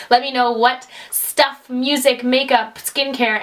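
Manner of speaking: usual speaking voice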